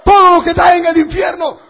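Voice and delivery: high, loud, fast shout; full-force preaching; thundering warning. Real, not acted. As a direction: cry it out over the congregation.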